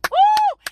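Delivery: high, loud "woo"; excited whoop. Real, not acted. Preacher punctuating a point, excited whoop. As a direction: let out a sudden high whoop.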